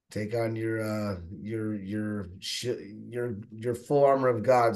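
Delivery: halting, stumbling over repeated words; real stuttering. Real, not acted. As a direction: stumble and restart while searching for words. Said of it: "sorry, I'm stuttering right now".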